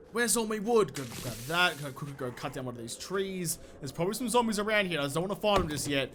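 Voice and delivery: ordinary delivery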